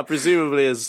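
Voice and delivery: ordinary talk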